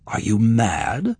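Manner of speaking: slow, disbelieving question; incredulous exclaim. Acted. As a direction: drag it out in disbelief. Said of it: "Kira exclaimed".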